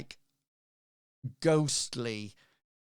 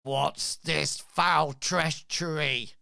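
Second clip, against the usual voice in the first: slow, louder, seething hiss of outrage; hissing in anger; 4 semitones higher, 7 dB louder, slower than usual. Acted. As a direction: spit the words out slowly, like a furious vampire captain. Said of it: "and he hisses".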